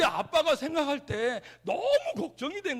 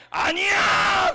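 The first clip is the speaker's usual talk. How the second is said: "아니야!" much higher and louder, slow and wailing; sudden crying shout; 10 semitones higher, 11 dB louder, much slower than usual. Retold or imitated. Pastor suddenly shouting down the students' answer. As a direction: cut them off with a cry. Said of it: "갑자기 목사님이".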